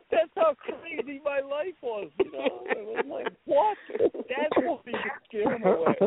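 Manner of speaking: high-pitched, talking through laughter; laughing. Real, not acted. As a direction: crack up at your own story. Laughs at his own punchline.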